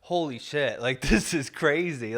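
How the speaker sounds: slow, amazed laugh through the words; laughing disbelief. Retold or imitated. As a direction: laugh it out, stunned. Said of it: "And I'm like".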